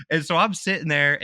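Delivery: ordinary talk, nothing marked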